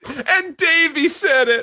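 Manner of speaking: mock excited cheer. Joining mock family cheer.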